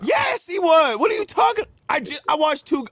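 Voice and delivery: high-pitched, incredulous outburst; real disbelief, pitched high; indignant protest. Real, not acted. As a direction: argue back, voice shooting way up. Heated disagreement over a movie.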